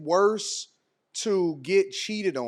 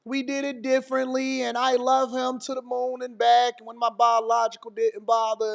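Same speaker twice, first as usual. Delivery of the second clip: higher, gushing mock voice; mocking a commenter; 7 semitones higher than usual. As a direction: send up a smug stepkid in the chat. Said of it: "people inside of the chat like".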